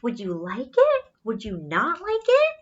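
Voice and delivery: ordinary delivery, nothing marked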